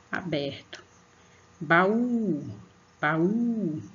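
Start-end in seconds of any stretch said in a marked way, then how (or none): 1.6-3.8 "Baú" called out, the "ú" held long; stress on last syllable. Shown as a demonstration. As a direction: open twice, pushing the "ú" of "Baú". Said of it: "o U é a sílaba mais forte".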